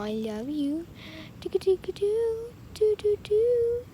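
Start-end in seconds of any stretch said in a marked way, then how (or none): 1.4-3.8 pitched-up sing-song nonsense for a pet; high nonsense coo. Real, not acted. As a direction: babble at the kitten up high. Nonsense sounds to kitten.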